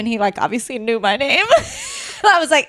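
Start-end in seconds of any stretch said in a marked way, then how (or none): 1.5-2.2 high squealing laugh. Real, not acted. Laughing at ex's reaction.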